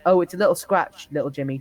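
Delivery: usual speaking voice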